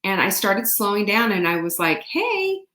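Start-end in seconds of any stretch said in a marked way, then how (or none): 2.0-2.6 a much higher, friendly hey to the neighbor; cheery hey. Shown as a demonstration. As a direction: call out a bright little hey. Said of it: "like, just like this".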